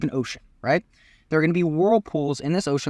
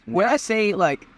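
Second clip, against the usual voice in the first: ordinary talk, nothing marked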